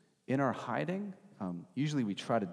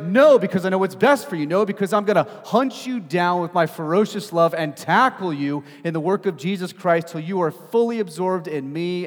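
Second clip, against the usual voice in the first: loud, high, relentless declaration; impassioned preaching; 8 semitones higher, 13 dB louder than usual. Acted. Preacher voicing God refusing to let go, loud and forceful. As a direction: preach it as an unstoppable promise.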